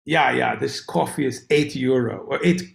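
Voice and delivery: put-on improvised Swiss local's accent; vague European accent. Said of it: "I don't know what accent that is".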